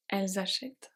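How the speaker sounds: usual speaking voice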